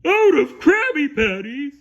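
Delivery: loud, high cartoon disbelief; surprised Patrick. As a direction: echo it back in shock.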